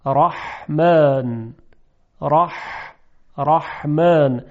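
slow رحمن with a tight, pressed ح; constricted pharyngeal he. Shown as a demonstration. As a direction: squeeze the throat on the ح of رحمن. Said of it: "اما هی جیمی". (تلفظ رحمن با حای جیمی، گرفته)

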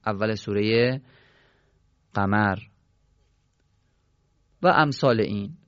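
usual speaking voice

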